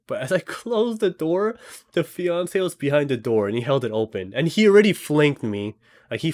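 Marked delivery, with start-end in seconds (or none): none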